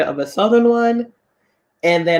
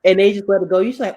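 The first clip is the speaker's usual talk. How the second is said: normal talking voice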